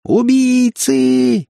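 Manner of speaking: very high, drawn-out shriek; thin-voiced scream. A narrator's reading. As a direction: scream it thinly, nearly squealing. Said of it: "закричала тонким голосом, срываясь на визг". (тонкий крик, срывающийся на визг)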